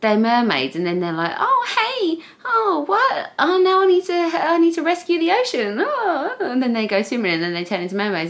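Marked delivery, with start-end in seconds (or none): 1.4-6.3 high-pitched mock mermaid chirp; mocking high-voiced mermaid drama; ditzy TV mermaid. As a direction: put on a high, airy-headed mermaid voice. Imitates silly TV mermaids.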